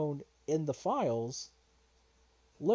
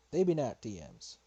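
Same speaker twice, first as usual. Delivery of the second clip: normal talking voice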